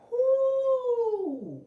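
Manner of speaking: a high, loud whoop of joy; a big high cry of wonder; ecstatic whoo. Real, not acted. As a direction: let out a joyous whoop. Ecstatic memory of visions.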